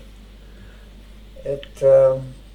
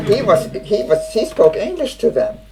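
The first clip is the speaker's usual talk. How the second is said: higher and louder, the words carried on real laughter; laughing while talking; 6 semitones higher, 5 dB louder than usual. Real, not acted. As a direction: keep laughing as you finish the point. Laughing through her punchline.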